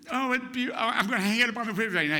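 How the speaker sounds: high, fast, excited babble; gushing mother. Retold or imitated. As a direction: tumble over the words with delight. Mother delighted with drawing, retold.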